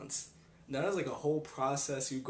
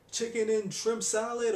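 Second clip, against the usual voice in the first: waitress getting order wrong; 9 semitones higher, 5 dB louder than usual. Retold or imitated. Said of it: "a waitress comes over to my table".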